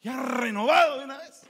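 loud, high shout of renewal; mock triumphant shout. Preacher acting the freed man, shouting in triumph. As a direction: proclaim it like a victory.

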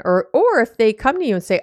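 normal talking voice

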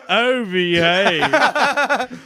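very slow, drawn-out, laughing catchphrase; Austin Powers impression. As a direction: purr it out slow and cheeky.

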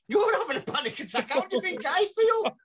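very high, exasperated shout; hammed-up fury, rising to a yell; indignant retort. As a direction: throw it back in outrage. Teller retorts angrily to roommate.

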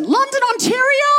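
very high, sing-song church lady voice; nosy older sister. Retold or imitated. Older sister quizzing a visitor at the door, high and sweet. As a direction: chirp it out like a sweet busybody.

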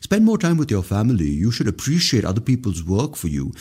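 mocking government preaching at citizens; mock preachy politician. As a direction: lecture like a smug official. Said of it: "they start preaching".

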